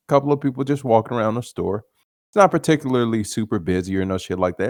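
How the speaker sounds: normal talking voice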